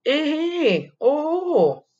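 sing-song warning from mom; mother scolding. Acted. Mom playfully warns the child about candy, high mock scold. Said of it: "Elle me dit".

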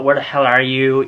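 usual speaking voice

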